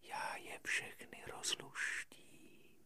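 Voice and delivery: hushed, slow snake whisper. Acted. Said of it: "odpověděl had". (šeptající had)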